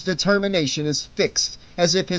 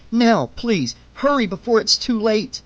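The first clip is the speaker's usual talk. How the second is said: higher, pressing plea; urgent pleading; 6 semitones higher than usual. A narrator's reading. Woman pleading with herself as attacker looms over her, urgent. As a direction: beg for action before time runs out. Urging herself to strike in time.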